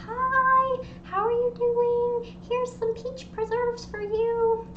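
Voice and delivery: high, slow, drawn-out greeting; sweet neighbor. Acted. Neighbor bringing peach preserves, sweet high voice. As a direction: coo it high and slowly, like a kindly caller. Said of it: "and she's like".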